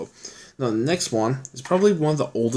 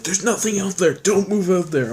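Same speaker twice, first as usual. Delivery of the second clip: higher, half-joking warning voice; mock dismissive friend; 8 semitones higher than usual. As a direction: imitate a friend waving the idea off, pitched up. Buddy Jordo warning about Newfoundland.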